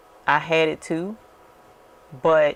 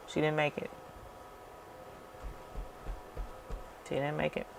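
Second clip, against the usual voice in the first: much softer, heavy repeated words; sad, quiet; 16 dB quieter, faster than usual. Real, not acted. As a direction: let it drop almost to nothing. Telling of the woman's death.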